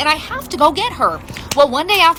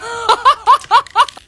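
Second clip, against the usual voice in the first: loud high laugh; 9 semitones higher, 5 dB louder than usual. Real, not acted. Mom laughing at her daughter's trick, loud laugh. Laugh after story punchline.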